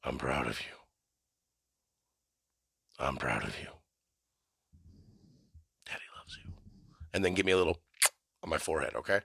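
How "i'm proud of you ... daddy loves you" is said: very low, barely audible father voice; hushed mock-intimate bedtime reassurance from a father; tender daddy. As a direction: murmur it deep and soft, sinking to a whisper. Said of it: "stroke the side of my face while you say it".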